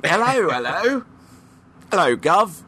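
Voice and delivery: hammed-up Cockney greeting; playful Cockney street voice; Cockney accent. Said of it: "read your lists on air in a Cockney accent".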